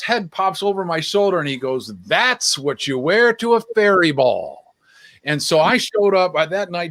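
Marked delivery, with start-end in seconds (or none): 2.1-4.7 slow, drawn-out pronouncement; retold emphatic verdict. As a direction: stretch it out like a triumphant punchline. Friend's verdict on the costume.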